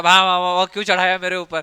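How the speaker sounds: a loud barking yelp; a hint of a hurt dog complaining. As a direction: bark like a run-over dog. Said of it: "कभी कुत्ता जो रहता है".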